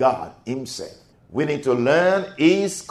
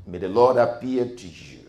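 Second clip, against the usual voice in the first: ordinary talk, nothing marked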